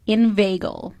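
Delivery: ordinary talk, nothing marked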